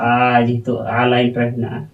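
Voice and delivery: ordinary talk, nothing marked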